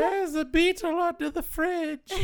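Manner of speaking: very high, screeching falsetto scold; overbearing mother. Acted. Insufferable mother ordering her son to kill a beetle, shrieking scold. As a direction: nag your son in a piercing mother voice. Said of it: "like, um, Norman Bates's mother".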